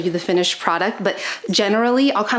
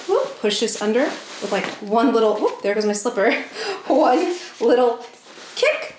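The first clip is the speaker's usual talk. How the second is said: ordinary talk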